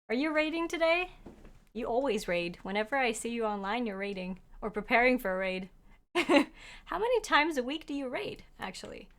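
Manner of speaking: ordinary talk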